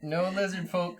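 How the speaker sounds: high, loud, laughing no; laughing refusal, voice up; excited refusal. Real, not acted. Refusing the lizard folk.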